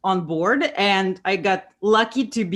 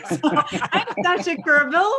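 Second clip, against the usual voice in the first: chuckling through her own name, pitch up; giggly self-introduction; laughing; 8 semitones higher, 3 dB louder than usual. Real, not acted. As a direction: laugh it out while introducing yourself. Laughing about her alarm clock.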